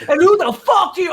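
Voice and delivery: high, loud mock rage at a young comic; mock angry yell. [name] imitates John Heyman screaming at her, mock furious. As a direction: bark it like a mean old comic. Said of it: "this vision of him".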